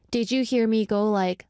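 ordinary talk, nothing marked

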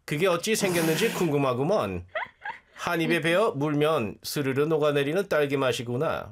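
put-on sung fast in a North Korean accent. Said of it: "How does 빨간 맛 sound in North Korean accent?".

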